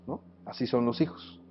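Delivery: ordinary talk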